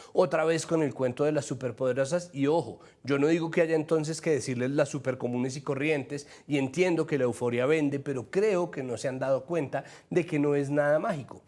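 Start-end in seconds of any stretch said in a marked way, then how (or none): none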